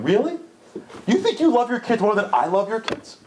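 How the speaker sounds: God half-amused at the parent's claim; mock incredulous divine voice. God answers praying parent.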